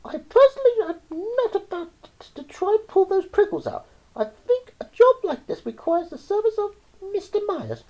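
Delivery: very high-pitched mother's voice; level-headed mum. A narrator's reading. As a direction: play the mum in a high, put-on voice. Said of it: "and then she said".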